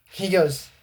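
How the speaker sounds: ordinary delivery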